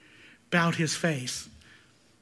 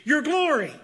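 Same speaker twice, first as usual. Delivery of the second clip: Moses pleading; 8 semitones higher, 5 dB louder than usual. Retold or imitated. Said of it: "Moses' request was".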